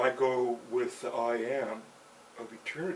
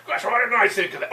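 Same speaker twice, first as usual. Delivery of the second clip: sarcastic mock gunman; 9 semitones higher, 10 dB louder than usual. Mocking the gunman's reply.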